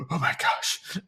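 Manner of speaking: a startled hush after a gasp; frightened whispered oh my gosh; shocked whisper. Retold or imitated.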